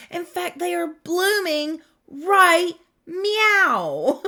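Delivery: teasing pun on blooming plants; playful meow. Real, not acted. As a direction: stretch out right meow like a cat joke.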